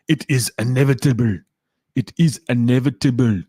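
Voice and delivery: hammed-up Arnold-style Austrian accent on a film quote. Streamer quotes a film line to chat in a bad Arnold Schwarzenegger accent. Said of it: "bad accent".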